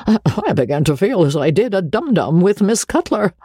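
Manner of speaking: high-pitched stammering start with a chuckle after; excited musing ending in a little laugh; flustered, self-pleased Joseph. A narrator's reading. Voices Joseph thinking to himself.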